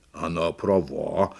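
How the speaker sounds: drawn-out elephant verdict; little elephant. A narrator's reading. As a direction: agree solemnly as a baby elephant. Elephant agrees.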